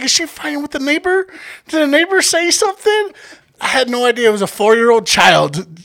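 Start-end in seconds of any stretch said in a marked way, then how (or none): 0.0-3.1 high, incredulous retold questions; baffled bystander. Friend guessing it's the neighbor she is fighting, baffled. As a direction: wonder aloud, pitched way up.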